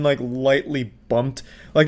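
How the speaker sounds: normal talking voice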